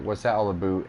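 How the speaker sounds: put-on "about" said as "aboot" in a Canadian accent; lightly voiced Canadian accent; mock Canadian accent. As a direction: imitate a Canadian saying "aboot". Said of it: "When someone from Canada hears someone from California".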